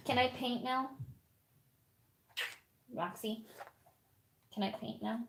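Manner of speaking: hushed, playful plea to the puppy; soft plea. Real, not acted. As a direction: beg the puppy quietly to let you paint. Pleading quietly with puppy.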